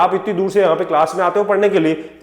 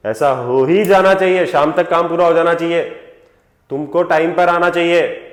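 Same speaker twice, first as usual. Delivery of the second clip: louder, firm demand stressing "ही"; boss's must tone; 4 dB louder than usual. Acted. Boss to staff about finishing work, must tone. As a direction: lay down orders with no room to refuse. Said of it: "must tone".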